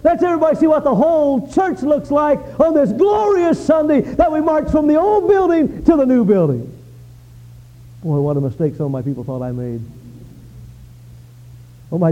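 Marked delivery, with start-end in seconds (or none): 0.0-6.7 rising to a joyful shout; excited announcement. Retold or imitated. Pastor retelling his plan to the church, excited shouting. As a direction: pastor proclaiming a glorious march, building to a shout. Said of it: "and so I thought".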